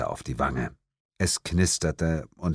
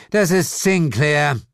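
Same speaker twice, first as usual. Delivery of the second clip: slow, higher-pitched, weary correction; bored correction; 8 semitones higher, 5 dB louder than usual. A narrator's reading. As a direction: correct him slowly, as if bored. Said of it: "korrigierte Lilith gelangweilt". (gelangweilt korrigieren)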